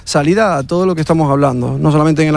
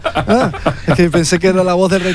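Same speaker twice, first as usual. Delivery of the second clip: amused, higher voice with laughter; laughing; 4 semitones higher than usual. Real, not acted. Guest to co-host explaining a mistaken voice, laughing. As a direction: explain the mix-up through a laugh.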